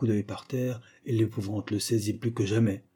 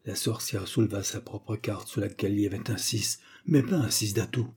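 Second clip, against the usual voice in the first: ordinary delivery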